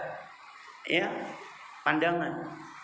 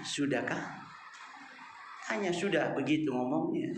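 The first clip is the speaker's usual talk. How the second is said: much softer, lower mock-gentle ask; quiet question; 4 semitones lower, 5 dB quieter than usual. Husband softly asking his talkative wife if she is finished, quiet and mock-gentle. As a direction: ask softly whether she is done talking.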